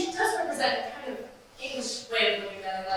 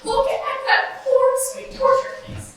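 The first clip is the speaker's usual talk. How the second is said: much higher and louder, gushing over him; gushing theatrical greeting; 12 semitones higher, 10 dB louder than usual. Acted. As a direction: sweep in gushing like a diva. Actress gushing on entrance.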